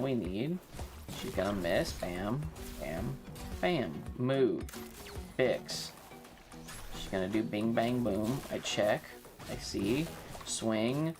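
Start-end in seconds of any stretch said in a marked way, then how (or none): none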